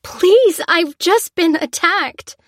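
high, slow pleading; desperate plea. Acted. Lucy begging the soldier on the ground, high. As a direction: beg them to listen.

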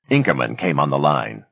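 ordinary delivery, nothing marked

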